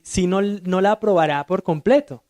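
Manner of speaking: ordinary delivery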